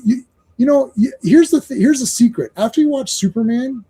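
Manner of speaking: normal talking voice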